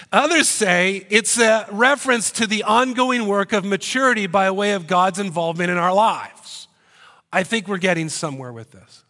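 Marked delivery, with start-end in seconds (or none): 0.1-6.7 loud emphatic restart. Real, not acted. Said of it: "I'll slow down".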